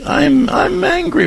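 put-on very high, slow little-girl voice; squeaky toddler naming her feeling; little child's voice. Shown as a demonstration. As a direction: voice the four-year-old saying it. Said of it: "Have her say it when she says".

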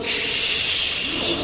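long, soft hushing shh; mock-soothing shush. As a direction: hush away someone's conscience. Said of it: "culture of death today would tell you".

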